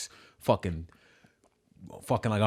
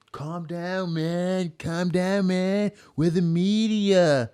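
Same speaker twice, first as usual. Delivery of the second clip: much higher, slow mock voice of a preachy cameraman; 10 semitones higher, much slower than usual.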